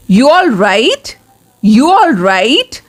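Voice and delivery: slow "You all right" rising at the end; drawn-out "You all right" with a clear upward turn on "right"; questioning tune. Shown as a demonstration. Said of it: "मेरी आवाज़ से ... पता चल गया होगा".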